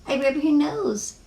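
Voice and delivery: little friend sharing in turn; lightly voiced small girl.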